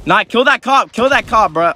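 high-pitched, frantic urging; urgent urging. Real, not acted. Gamer urging a kill on a cop, high and urgent. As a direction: urge it on in a sudden high voice. Urging action during game fight.

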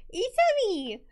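put-on high, lightly Italian accented; a quick cartoon Italian echo; light mock Italian accent. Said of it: "trashy Italian accents".

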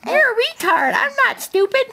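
put-on very high squeaky baby voice. Dad voicing his baby in a funny voice.

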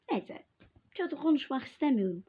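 ordinary talk, nothing marked

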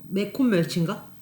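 ordinary talk

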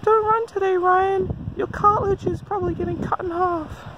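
very high, nagging whine; whiny little voice. Retold or imitated. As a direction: whine like a pesky inner voice. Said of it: "that whiny little voice".

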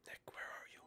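hushed whisper while hiding; soft hiding whisper. Real, not acted. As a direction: whisper so nobody hears. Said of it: "hello, Nick".

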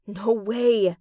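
usual speaking voice

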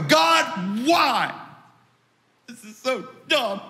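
hammed-up anguished appeal; mock exasperated cry. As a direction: cry out to heaven in protest. Acted complaint to God from prison.

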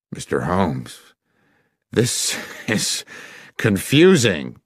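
a lightly voiced, bewildered Masterson with a nervous laugh; flustered gentleman. Masterson protests to Holmes he is confused, nervously laughing. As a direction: stammer out the confusion, half-laughing.

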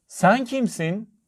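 high, slow calling voice; called out. A narrator's reading. Little girl calls to the unseen voice, high call. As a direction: call it out high, stretching the words. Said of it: "diye bağırdı".

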